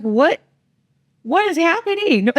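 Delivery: high-pitched stunned disbelief; shocked what. Retold or imitated. Mom bursting out in high-pitched shock at her panicking daughter. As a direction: act like you walked in on total chaos.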